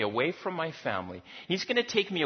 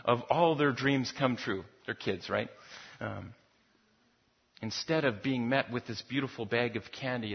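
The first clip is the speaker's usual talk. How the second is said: usual speaking voice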